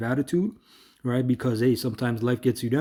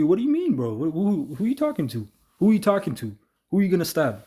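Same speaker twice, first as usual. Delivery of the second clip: ready to fight, pitched way up; heated confrontation; 5 semitones higher than usual. Retold or imitated. Preacher replaying his old self confronting the stranger, heated. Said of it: "But my old self".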